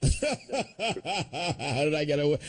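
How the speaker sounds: laughing through the words, slowly; chuckling as he talks. Real, not acted. Host laughing at his line.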